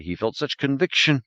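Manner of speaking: usual speaking voice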